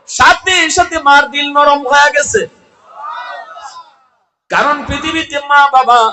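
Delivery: high, loud sermon cry; full-force preaching. Real, not acted. (উচ্চস্বরে জোরালো ওয়াজ)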